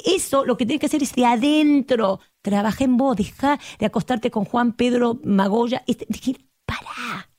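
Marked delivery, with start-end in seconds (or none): 5.9-7.3 hushed whispered pará; soft whispered plea to stop. Acted. Urging someone to stop.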